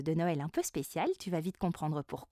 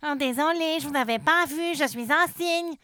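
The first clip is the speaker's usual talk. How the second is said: higher, polite swan voice; 6 semitones higher, 6 dB louder than usual. Acted. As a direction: apologise as a prim swan. The swan apologises.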